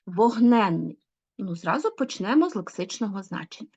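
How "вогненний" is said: Вогненний with НЕН stressed and drawn, then falling; stressed suffix. Shown as a demonstration. Teacher explaining to pupils the stressed suffix, вогНЕНний stressed on НЕН. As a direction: lean hard on НЕН in Вогненний. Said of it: "додаванням наголошеного суфікса".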